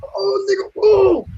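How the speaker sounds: very high wailing mock cry; anguished cry. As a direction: cry out in hammed-up dismay. Voicing a movie character's outburst.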